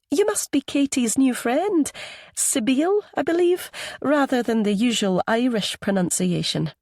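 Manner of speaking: high, inquisitive Anglo-Scottish woman; Anglo-Scottish accent. A narrator's reading. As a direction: probe politely in an Anglo-Scottish lilt. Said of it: "in that same Anglo-Scottish accent".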